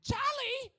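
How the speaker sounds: loud, high New Jersey holler; New Jersey accent. Shown as a demonstration. Calling out the mascot's name, New Jersey shout. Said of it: "You say Charlie".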